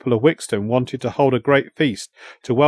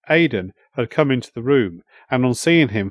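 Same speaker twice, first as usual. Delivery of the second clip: ordinary delivery, nothing marked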